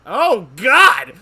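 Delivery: real shocked shout, high and loud; startled outcry. Real, not acted. Player seeing the Chief Justice, startled shout. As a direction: burst out in loud, high alarm.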